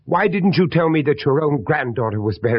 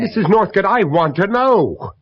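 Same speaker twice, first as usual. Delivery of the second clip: angry insistence; 7 semitones higher, 6 dB louder than usual. Acted. Father insisting the widow answer him, angry. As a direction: plant each word, demanding.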